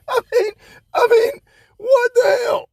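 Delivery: high, loud outraged yell of disbelief; exasperated shout. Real, not acted. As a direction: cry out in disbelief. Outraged at story.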